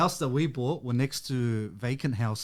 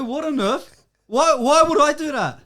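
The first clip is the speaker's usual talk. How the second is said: higher, louder mock indignation; retold outrage; 13 semitones higher, 7 dB louder, slower than usual. Telling friends how he answered dad, mock outraged. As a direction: act offended for the story. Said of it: "I go".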